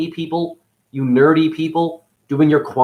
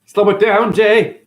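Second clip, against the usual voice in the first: loud call to Jay; 6 semitones higher, 6 dB louder than usual. Real, not acted. Telling the editor to slow down.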